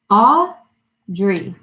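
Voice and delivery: "Audrey" broken in two, Au... drey; syllable by syllable. Shown as a demonstration. Teacher asking how many syllables, "Audrey" split in two. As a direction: clap out "Audrey" with a gap between syllables. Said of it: "We can clap it out".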